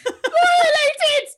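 high, loud violated shout with laughter; mock shouted violated, laughing. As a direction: shout it while cracking up. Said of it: "She's just standing there saying".

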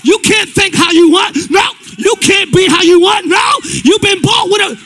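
loud, rising preacher calling to the crowd; full-force preaching. Acted. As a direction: preach it hard and loud to the congregation.

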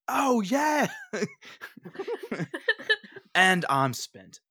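loud high mock-ecstatic yell; hammed-up ecstasy. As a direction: overact the thrill then collapse. Hammed-up posing climax.

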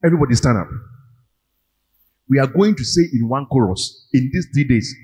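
rally the congregation, building up; booming motivational speech. Acted. Leads congregation call and response.

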